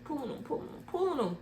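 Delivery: high, soft strained repetition; mock straining. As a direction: act out straining to pull someone. Acting out friends dragging him.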